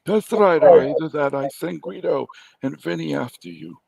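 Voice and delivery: high, loud gangster threat; mob boss voice. Pretend. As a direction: play the mafia don.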